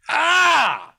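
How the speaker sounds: loud high pained cry; sensitive teeth wincing. Real, not acted. Wincing at tinfoil thought.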